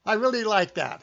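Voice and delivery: ordinary talk, nothing marked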